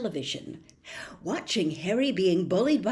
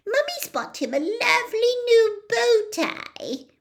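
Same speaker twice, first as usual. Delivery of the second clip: much higher doting mum voice; doting mother; 6 dB louder than usual. A narrator's reading. Petunia cooing over Dudley's new bow tie. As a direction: coo it like a proud mummy.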